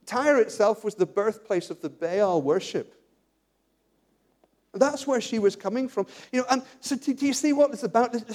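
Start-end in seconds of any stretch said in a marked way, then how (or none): none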